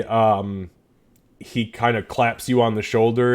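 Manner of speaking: normal talking voice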